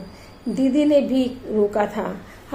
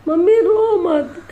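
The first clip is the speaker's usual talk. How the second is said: high, louder pleading plea; pleading child; 7 semitones higher, 3 dB louder than usual. Acted. Daughter begs crying mother to stop, high pleading.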